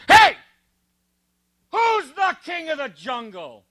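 high, puffed-up lion voice; swaggering lion. Pretend. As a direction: strut and demand it like a bully lion. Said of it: "and he goes".

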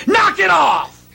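high, loud, slow rebuke; commanding shout. Retold or imitated. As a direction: order the storm to stop. Jesus rebukes the storm.

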